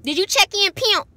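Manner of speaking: hammed-up coworker impression; mocking coworker voice. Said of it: "y'all, like".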